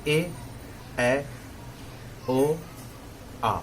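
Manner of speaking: ए, ऐ, ओ, आ one by one, mouth half open; half-open vowels. Shown as a demonstration. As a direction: sound each of ए, ऐ, ओ, आ separately with a half-open mouth. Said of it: "मुंह आधा खोलेंगे". (आधे खुले मुंह से ए, ऐ, ओ, आ अलग-अलग)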